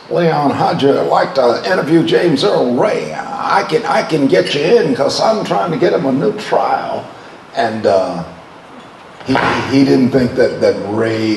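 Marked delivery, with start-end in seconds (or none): 0.1-7.1 high-pitched imitation of an eager pitch; Larry Flynt. Pornographer pitching a prison interview to a TV reporter, imitated high. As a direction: put on a high voice, retelling someone's proposition. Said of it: "he came to me one day".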